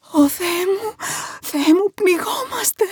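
high-pitched cry of terror; gasping, high cry of alarm; panicked shriek. A narrator's reading. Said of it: "έσκουξε".

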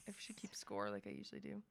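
unvoiced whisper to herself; breathy murmur, half to herself; a quick whispered side remark; whispered aside. Real, not acted. Aside muttered to self.

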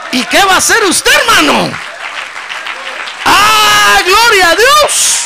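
very loud, high, ringing preacher's cry; preaching at full force. Acted. Preacher challenging the congregation, then crying out praise loudly.

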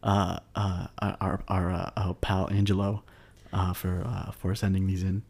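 stumbling through with lots of uhs; real fumbling, stop-start delivery; halting hesitation. Real, not acted. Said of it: "you keep saying this like this".

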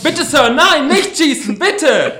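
very high, loud desperate plea; panicked begging. Acted. Boy begs the gunman not to shoot, panicked scream. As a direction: scream for mercy in terror.

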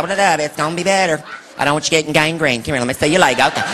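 mom's low fuss. Retold or imitated. Mom spraying a scraped knee, low and brisk. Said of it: "your mom would spray Bactine".

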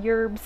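"yerbs" with a Y sound in front; playful mispronunciation. Shown as a demonstration. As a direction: say "yerbs", opening on a Y like "yurbz". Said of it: "I call them yerbs with a Y".

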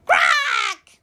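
high, harsh squawk; sudden high cackling cry; chicken squawk. A narrator's reading. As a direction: squawk like a hen. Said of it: "said the chicken".

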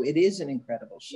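ordinary talk

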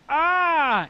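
high shrill-free mock scream, loud; a short startled yelp as the girlfriend; girlfriend's scream impression. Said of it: "Keep her impersonation".

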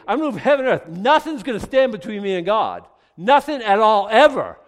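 high, loud, building fervent vow; zealous shout. Acted. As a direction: swear it with everything you've got. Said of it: "he's like".

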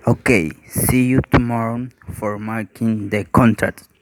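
usual speaking voice

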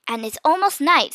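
ordinary delivery

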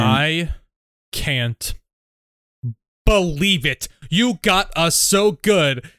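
drawn-out disbelieving parent; parent's line. Acted.

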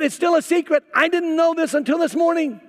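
high, loud aggrieved complaint; indignant groom. Retold or imitated. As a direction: insist you were wronged.

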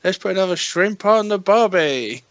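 high, slow hammy Aussie drawl; Australian accent. Acted. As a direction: play up a cartoon Australian. Mock Australian film character.

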